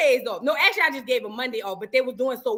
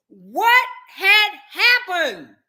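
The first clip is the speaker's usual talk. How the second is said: baffled; 5 semitones higher, 6 dB louder, much slower than usual. Real, not acted. Baffled exclamation.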